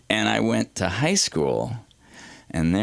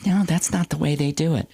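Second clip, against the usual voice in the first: put-on prissy kid; 8 semitones higher than usual. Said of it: "constantly be going".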